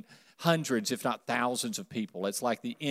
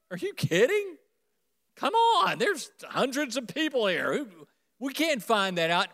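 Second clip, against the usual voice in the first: much higher, disbelieving voice; incredulous disciples; 8 semitones higher than usual. Acted. Disciples answering Jesus in disbelief.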